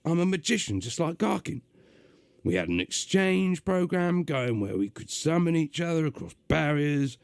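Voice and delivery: gruff, high London demon voice; gruff London accent. Acted. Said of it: "doing a gruff, uh, London accent".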